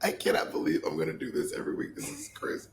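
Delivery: unvoiced whisper. Real, not acted. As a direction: whisper it in disbelief. Aside in disbelief.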